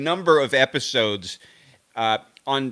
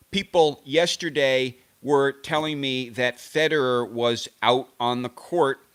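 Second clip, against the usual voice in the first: ordinary talk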